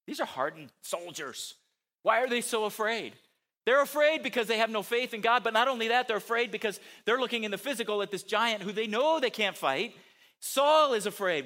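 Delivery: loud, high, driving preacher voice; full-force preaching. Acted. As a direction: preach it hard to the congregation.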